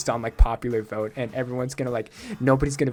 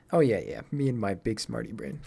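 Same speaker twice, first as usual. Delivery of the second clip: normal talking voice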